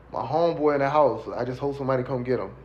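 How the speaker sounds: ordinary talk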